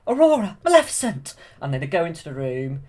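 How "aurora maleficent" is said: loud, high alarmed calling. Retold or imitated. Fairies realizing the danger, loud high alarmed calling. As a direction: shout the names in alarm. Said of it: "and then they're like".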